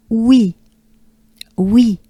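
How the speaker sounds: "oui" with a quick French glide to i; crisp French "oui". Shown as a demonstration. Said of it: "the French".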